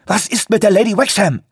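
high, agitated shout; frantic demand. A narrator's reading. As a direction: demand an answer, beside yourself. Frantic man demands news of boat.

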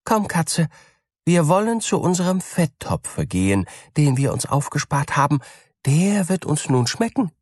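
high, eager mouse voice; cheerful mouse. A narrator's reading. Mouse inviting the cat to the fat pot, high and glad. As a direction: invite the cat to the feast gladly.

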